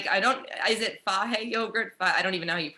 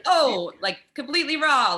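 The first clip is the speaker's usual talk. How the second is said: higher, louder outburst over raw veg; excited exclaim; 6 semitones higher, 5 dB louder than usual. Real, not acted. Friend recalling raw vegetables to the host, louder and higher. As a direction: exclaim it, appalled and amused.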